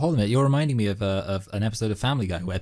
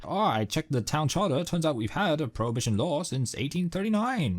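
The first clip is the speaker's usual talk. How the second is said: Simpsons town official; 7 semitones higher than usual. Retold or imitated. Co-host re-enacts the Prohibition episode for his friend, high cartoon voice. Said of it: "basically, um, he says".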